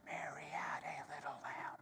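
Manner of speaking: soft unvoiced whisper of the rhyme. Shown as a demonstration. Yoga teacher demonstrating a whisper to the class. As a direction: breathe the words out with no voice. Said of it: "but saying it as a whisper".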